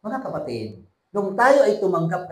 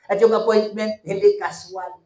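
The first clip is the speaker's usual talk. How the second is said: louder, punchy first take; emphatic preaching; 5 semitones higher, 5 dB louder than usual. Acted. Preacher stressing the appointment to church. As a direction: drive the point home. Rhetorical contrast, rising.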